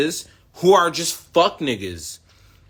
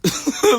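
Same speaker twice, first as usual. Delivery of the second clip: high laugh; short high-pitched burst of laughter; 3 dB louder than usual. Real, not acted. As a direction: laugh at your own outburst. Laughs at own outburst.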